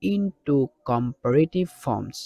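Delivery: each word stretched very slowly; slow dictation reading. Shown as a demonstration. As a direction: read it slowly so pupils can write. Teacher reads exercise heading aloud.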